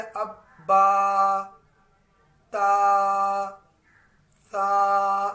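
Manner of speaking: letters held very long and loud; slow loud tajweed demonstration; drawn-out recitation. Shown as a demonstration. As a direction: stretch each letter for students to copy. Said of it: "इसको हम बंद किया".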